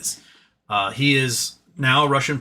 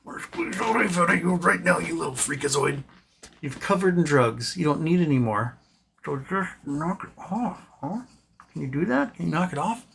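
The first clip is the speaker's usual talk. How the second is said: put-on pet baby talk; 3 semitones higher, 4 dB quieter than usual. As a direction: coo at the pet like scolding a baby. Cooing mock threats to pet.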